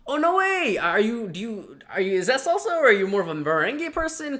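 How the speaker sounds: high, loud, gushing no way; thrilled recruiter. Retold or imitated. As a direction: squeal with delight at a shared hobby. Recruiter gushing about dance.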